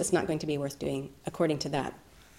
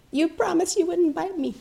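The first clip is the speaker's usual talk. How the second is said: betrayed rabbit; 12 semitones higher than usual. Acted. Rabbit after the bite.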